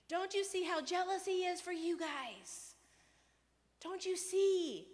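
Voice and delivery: high, loud, pleading rant; mock rant. Preacher voicing Jesus pleading with the crowd. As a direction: hammer the question home, exasperated. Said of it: "he goes on this rant".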